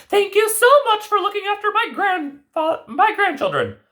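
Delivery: high fairy-tale granny voice; grandmother voice. Acted. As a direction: play the knowing old grandmother. Grandmother character voice.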